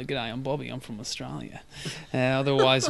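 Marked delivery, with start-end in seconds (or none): 0.0-1.6 a lightly done, quiet Australian accent; easy Australian twang, softly. Shown as a demonstration. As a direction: toss off a casual Australian G'day. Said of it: "I'd be, uh, talking like this".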